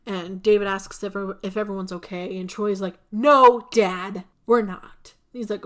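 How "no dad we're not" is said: put-on loud teen retort; mock shout. Son snapping back at his dad after the crash, loud.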